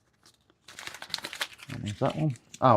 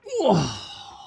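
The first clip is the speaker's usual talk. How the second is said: high, straining fake grunt; hammed-up grunt as if lifting something heavy; mock effort grunt; 10 semitones higher than usual. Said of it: "Makes some noises, pretending it's heavy".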